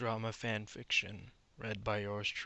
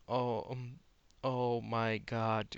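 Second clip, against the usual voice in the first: plain reading voice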